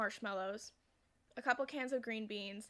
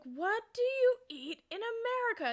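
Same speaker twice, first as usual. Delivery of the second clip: high, louder baffled outcry; exasperated disbelief; 12 semitones higher, 7 dB louder than usual. Retold or imitated. Family brings the joke back, exasperated mock disbelief. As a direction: draw it out in mock bewilderment. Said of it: "they're like".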